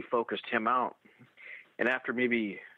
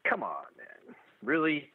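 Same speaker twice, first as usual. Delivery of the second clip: imitating a skeptical buddy; retold disbelief; 4 semitones higher, slower than usual. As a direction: doubt him, dropping then rising.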